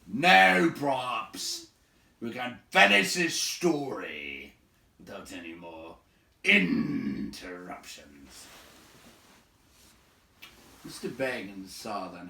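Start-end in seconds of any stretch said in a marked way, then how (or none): 0.0-8.5 slow, drawn-out interruptions with a falling stress; slow emphatic warning; pointed demand. Acted. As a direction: say it as a firm warning.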